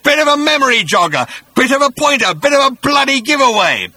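high, loud furious bellow. A narrator's reading. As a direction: bellow it in outrage. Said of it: "he bellowed".

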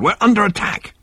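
high urgent alarm; snapped urgency. A narrator's reading. Ford warning of a Vogon attack, snapped high alarm. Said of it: "snapped Ford".

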